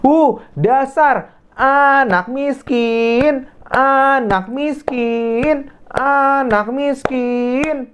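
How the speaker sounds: high, slow sneering taunt; mocking bully girl. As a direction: drag out the insult like a spoiled bully. Bully Rani taunts Dea. (mengejek dengan suara tinggi dan lambat)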